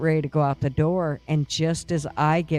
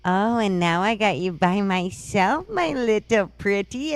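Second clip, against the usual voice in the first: higher, witchy taunt; imitating a witch; 5 semitones higher than usual. Haunted house actress taunting her trapped visitor in a mock witch voice. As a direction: purr it like a wicked witch. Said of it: "she's like".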